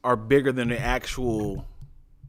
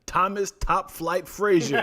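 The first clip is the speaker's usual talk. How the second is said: slow, higher mock ring introduction, amused; ring announcer; 5 semitones higher, 3 dB louder than usual. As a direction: stretch it out like a boxing introduction. Announcing an invented boxing name.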